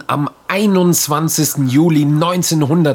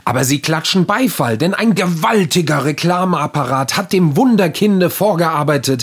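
story narration; 5 semitones higher than usual. Acted. Literary reading.

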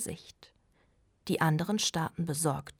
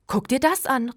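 higher and louder, calling the others over; called out; 7 semitones higher, 8 dB louder than usual. A narrator's reading. Melanie calling the others to look, loud. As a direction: call out to show them the find. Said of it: "rief Melanie".

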